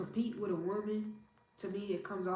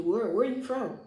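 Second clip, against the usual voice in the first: louder, higher excited call; eager question; 4 semitones higher, 9 dB louder than usual. Real, not acted. Passerby excitedly asks where he is from.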